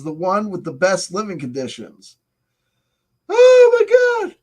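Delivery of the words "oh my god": yawned through the words, high and drawn out; big yawn. Real, not acted. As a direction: let a huge yawn stretch the words. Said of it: "I'm yawning".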